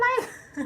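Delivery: sudden high laugh; high burst of laughter. Real, not acted. Laughing at friend's answer.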